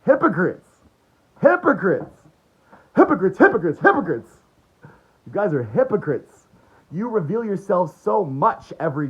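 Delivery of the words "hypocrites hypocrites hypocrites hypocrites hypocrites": loud, higher jeering chant; repeated loud accusation. Real, not acted. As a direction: hammer the word again and again. Ranting chant at opponents.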